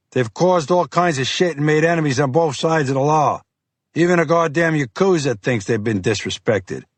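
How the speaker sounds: rising, heated agent. Acted. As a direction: voice climbing with anger. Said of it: "His voice ticked up in volume".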